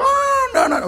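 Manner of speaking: put-on high little-girl voice pushing away; a toddler squealing no; child's refusal. Pastor voicing his little daughter refusing a kiss, high and squeaky.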